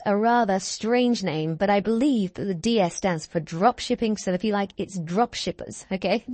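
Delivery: usual speaking voice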